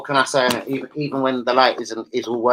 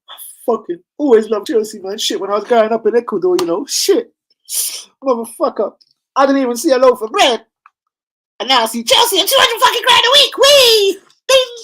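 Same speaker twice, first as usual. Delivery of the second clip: high, loud put-on voice of a footballer from Ecuador, much higher and louder; mock Caicedo voice; 13 semitones higher, 7 dB louder than usual. Arsenal fan voicing Caicedo bragging about Chelsea wages, high mock voice. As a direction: squeal it like a giddy player cashing in.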